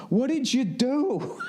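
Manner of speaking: slow, high mocking yell; mock high shout. Husband mocking his own question to wife, high slow shout. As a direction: whine it high and drawn out.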